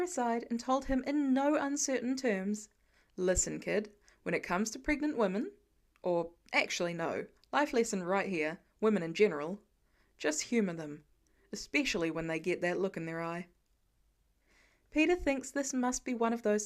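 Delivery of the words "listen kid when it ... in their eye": lower, laid-back mentor voice, a touch lower than the narrator; man-to-man tip, said low and knowing; Tony's advice. Acted. Tony giving life advice.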